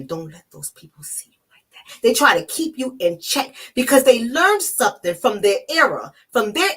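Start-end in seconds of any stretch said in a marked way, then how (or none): 0.0-2.0 softer, whispered warning from a Southern grandmother; grandmother's hushed scolding. Retold or imitated. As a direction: hush the child firmly, in a whisper. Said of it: "that tells you".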